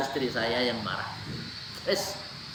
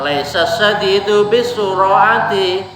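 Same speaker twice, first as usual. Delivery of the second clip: slow, loud hadith recitation; recitation hadis; 4 semitones higher, 7 dB louder, slower than usual. Real, not acted. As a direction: intone the Arabic slowly and firmly. (melafalkan hadis dengan lantang dan perlahan)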